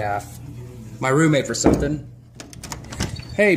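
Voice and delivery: ordinary talk, nothing marked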